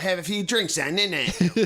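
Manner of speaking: high mock British accent. Mimicking the Birmingham girl at the hostel in a high British accent. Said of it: "that was how she talked".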